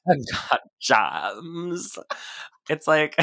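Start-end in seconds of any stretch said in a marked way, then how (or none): 0.1-2.0 slow creaky drawn-out fry; vocal fry. Retold or imitated. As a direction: drag it with a lazy creak. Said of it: "your vocal fry".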